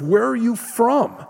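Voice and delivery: high, desperate pleading question; frantic Pilate. Retold or imitated. Preacher acting Pilate's frantic plea to Jesus. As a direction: push the question up in panic. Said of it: "Pilate begins to get frantic".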